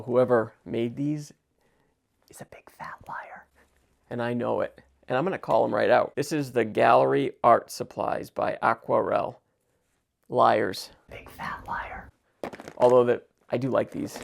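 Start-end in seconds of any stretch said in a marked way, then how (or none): 2.3-3.5 hushed, breathy aside calling them liars; conspiratorial whisper; stage whisper. Acted. Calling out the supply brand. 11.1-12.1 hushed, breathy aside calling them liars; conspiratorial whisper; stage whisper. Acted. Muttered aside at the brand.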